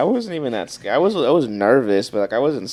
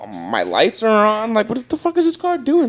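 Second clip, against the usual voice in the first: baffled outrage; 9 semitones higher than usual. Retold or imitated. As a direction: climb higher and higher in disbelief. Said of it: "I'm like".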